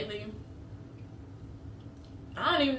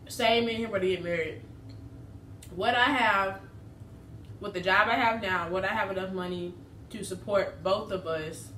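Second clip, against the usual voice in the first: chewing between words; talking while eating. Real, not acted. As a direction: mouth partly full. Seen 4: Holds wrapped food and raises it to her mouth while talking.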